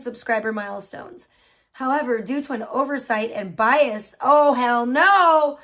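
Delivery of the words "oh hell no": very slow and much louder, stretched out; indignant interjection while reading the petition; emphatic oh hell no. Real, not acted. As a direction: drag it out in outrage. Reacting to bias against creator.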